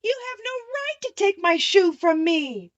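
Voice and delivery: put-on a child's outraged plea; indignant protest. A narrator's reading. Dorothy protesting to the witch, indignant. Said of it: "cried Dorothy".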